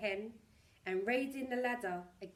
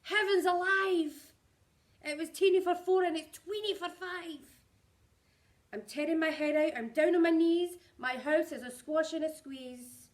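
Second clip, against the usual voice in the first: crying out in despair; 7 semitones higher, 9 dB louder than usual. A narrator's reading. Old lady crying out as the cow charges, high and loud. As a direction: tear her hair out loudly. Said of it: "Then the little lady cried".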